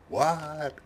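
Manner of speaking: high, drawn-out mock yell; hard-of-hearing old man. Elderly neighbor who can't hear his wife, mock yell. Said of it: "'Cause he can't hear".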